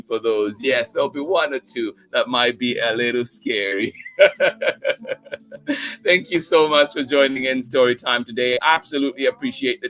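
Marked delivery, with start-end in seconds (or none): 4.0-6.0 rising, higher laugh after the warning; playful laugh. Real, not acted. Host teasing viewers about scary stories, playful laugh. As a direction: laugh it off mischievously.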